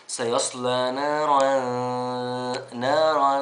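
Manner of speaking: نارا recited slowly and loud, tanween hidden into the noon; tajweed demo. Shown as a demonstration. Teacher showing students how to hide the tanween. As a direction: stretch out نارا without a clear noon. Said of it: "زي ما أنا هوريكم كده".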